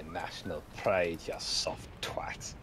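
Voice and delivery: hissed under the breath, slow; whispered insult. Acted.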